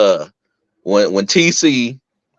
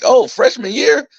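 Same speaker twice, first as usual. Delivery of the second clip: eager; 12 semitones higher, slower than usual. Retold or imitated. Retold noticing freshman girls.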